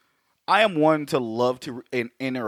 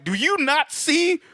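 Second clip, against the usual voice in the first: incredulous outcry; 9 semitones higher than usual. Retold or imitated. Friends in the theater, yelling in high disbelief. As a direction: ask in laughing disbelief why nobody sees it. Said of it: "he's laughing 'cause we're all like".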